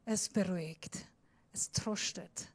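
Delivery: soft whisper; whispered comfort to the congregation. Shown as a demonstration. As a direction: whisper it soothingly. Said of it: "Aber wenn du flüsterst".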